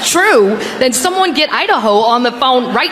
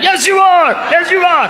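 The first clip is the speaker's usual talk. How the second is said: put-on baby talk to the cat; 7 semitones higher than usual. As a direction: gush over the cat like a baby. Cooing to the cat.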